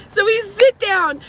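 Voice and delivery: high, loud and slow, milking the moment; loud story restart. Real, not acted. Vlogger restarting her story to viewers, high loud and slow. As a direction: drag it out big and loud for the camera.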